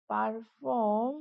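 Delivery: "Perform" drawn out slowly; slow dictation. Shown as a demonstration. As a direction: stretch both syllables of "perform" for learners. Teacher repeats the word slowly. ("Perform" ধীরে টেনে বলা)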